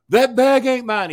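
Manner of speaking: mock-innocent denial; thief's denial. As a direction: play the caught thief lying. Thief denying the bag.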